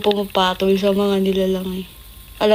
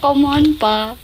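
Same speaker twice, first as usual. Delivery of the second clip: whiny plea; 7 semitones higher, 4 dB louder than usual. Retold or imitated. Pleading with teasing dad.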